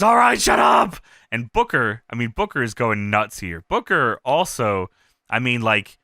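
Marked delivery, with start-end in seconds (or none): 0.0-1.0 loud, raised "shut up"; barking like Kurt; mock shout. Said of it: "So Kurt says".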